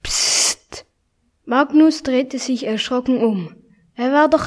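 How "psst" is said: sharp unvoiced psst from hiding; whispered hiss. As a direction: hiss for attention without voice. Hidden voice hisses for attention.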